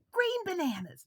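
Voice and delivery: high, loud playful guess; excited guess. Pretend. Storyteller guessing aloud for children, excited. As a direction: call it out like a bright idea.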